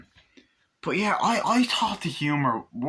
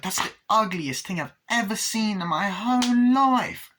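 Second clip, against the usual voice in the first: high-pitched, put-on voice of disgust; hammed-up horror, rising high; disgusted outburst; 8 semitones higher than usual. Woman reacting to an ugly thing, hammed-up disgust.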